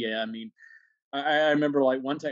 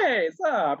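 higher-pitched playful hello; mock-casual, pitched up to be funny; jokey greeting; 7 semitones higher, 4 dB louder than usual. Defusing a tense moment with a stranger, playful. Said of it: "like, being funny".

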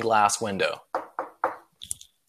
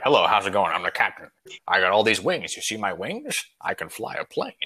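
low, slurred captain impression; deep mock Scottish film-star voice; Sean Connery; 4 semitones lower than usual. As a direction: greet passengers as a self-satisfied captain, pitched down. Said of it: "Sean Connery voice".

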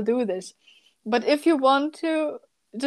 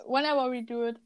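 ordinary talk, nothing marked

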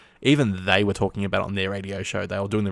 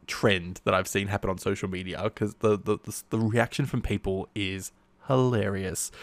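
ordinary talk

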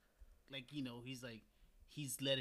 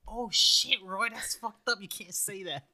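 a louder, higher mock shocked scolding; 6 semitones higher, 7 dB louder, slower than usual. Retelling how he scolded his friend, mock shocked. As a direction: act scandalized at your friend. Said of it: "And I was like".

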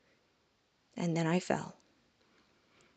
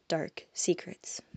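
hushed whisper; 8 dB quieter, slower than usual. A narrator's reading. Narrator hushing the demon's dark secrets, slow whisper.

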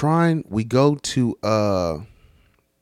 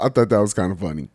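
normal talking voice

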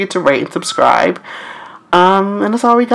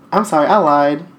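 ordinary talk

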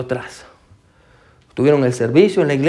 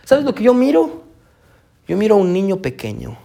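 kind pastor voice; 5 semitones higher than usual. Retold or imitated. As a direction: look at him and speak tenderly. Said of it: "el pastor lo mira y le dice". (el pastor le habla con ternura, voz aguda y lenta)

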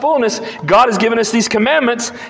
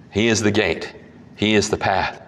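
low, soft, measured affirmation; quiet conviction, letting it land; hushed solemn; 11 semitones lower, 6 dB quieter than usual. Acted. Preacher softly affirming to congregation, hushed and low. Hushed follow-up after quote.

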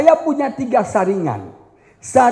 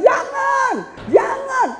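much higher, sharp mock no; forbidding; 14 semitones higher, much slower than usual. Pastor forbidding the youth, higher sharp no. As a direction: bark out no like a strict pastor.

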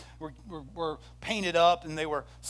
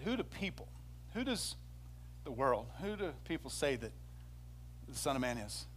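soft questioning Jesus; 2 semitones lower, 8 dB quieter than usual. Acted. Jesus asks his disciples.

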